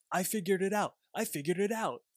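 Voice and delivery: lightly acted excited discovery; excited repeating. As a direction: blurt it out like a breakthrough. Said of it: "he was really excited".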